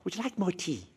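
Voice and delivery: mild air hostess offering tea. Retold or imitated. Air hostess offers tea to the returning passenger, gently. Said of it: "the air hostess says to you".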